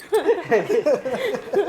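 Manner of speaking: relieved laughter. Real, not acted. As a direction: burst of repeated laughs. Laughing after the wife relents. (राहत भरी हँसी)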